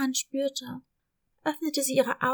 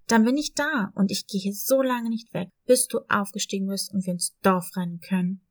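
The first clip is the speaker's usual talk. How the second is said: lower, steady dog voice, protective; lower, loyal dog reassuring the kitten; loyal puppy voice; 5 semitones lower, 5 dB louder than usual. Acted. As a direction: promise to stay by her side. Dog promises to stay.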